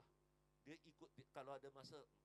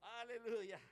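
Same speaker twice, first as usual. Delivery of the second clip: a shout of praise to the room; loud hallelujah; 7 semitones higher, 14 dB louder, slower than usual. Real, not acted. Preacher cries out to the congregation, loud and high.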